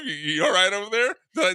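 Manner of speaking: ordinary delivery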